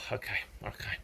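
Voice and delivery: sighing it off, defeated; resigned mutter. Retold or imitated. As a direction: give in with a tired sigh. Resigned after sigh, back to bed.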